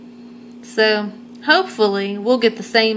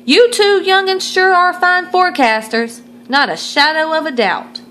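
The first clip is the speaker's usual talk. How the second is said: put-on higher, louder folksy old grandpa; 9 semitones higher, 4 dB louder than usual. Grampy reading the paper, folksy old grandpa exclaiming. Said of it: "said Grampy".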